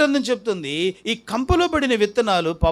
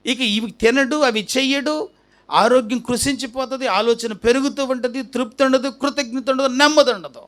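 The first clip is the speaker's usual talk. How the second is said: higher-pitched, insistent sermon list; forceful preaching; 6 semitones higher than usual. Real, not acted. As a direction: pile up each consequence. Preacher lists the consequences. (గొంతు పెంచి ప్రసంగిస్తూ)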